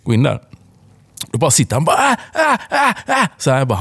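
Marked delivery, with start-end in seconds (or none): 2.0-3.3 series of short high imitated cries; mock repeated high screams; child's screaming. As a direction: mimic a child shrieking in short bursts. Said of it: "skriker så in i helvete".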